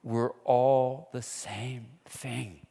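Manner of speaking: slow, mildly tearful quote; slow, understated imitation of a man crying; retold crying. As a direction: let the words come slowly as if near tears. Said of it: "and he's crying now".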